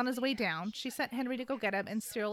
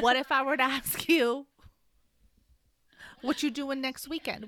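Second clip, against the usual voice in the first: louder, emphatic mock invitation; playful come-on; 3 semitones higher, 6 dB louder than usual. Guessing how Gideon meant to ask Sophie out, playful come-on. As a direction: ask it boldly, leaning into the question. Guessing a bolder second ending.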